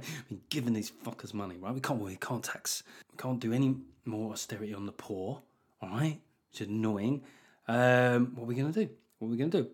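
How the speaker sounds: a Tory whining behind closed doors; mock Tory mutter. Tory grumbling privately.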